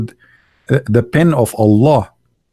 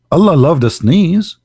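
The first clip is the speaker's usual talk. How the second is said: questioning repeat, higher and quicker; incredulous echo; 3 semitones higher than usual. Acted. Repeats it in disbelief.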